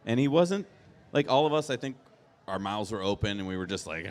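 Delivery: normal talking voice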